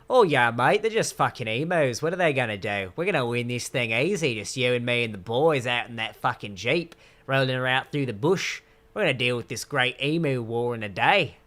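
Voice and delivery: a swaggering bloke in a mock Australian accent. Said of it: "I can't do an Australian accent".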